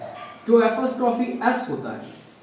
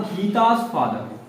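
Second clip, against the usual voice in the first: slow teaching demo; 3 semitones lower, much slower than usual. Shown as a demonstration. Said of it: "मैंने कहा".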